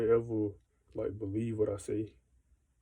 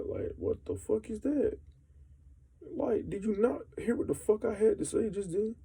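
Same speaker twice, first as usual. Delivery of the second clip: incredulous rant; 10 semitones higher than usual. Real, not acted. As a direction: squeal out the disbelief. Ranting about being ignored.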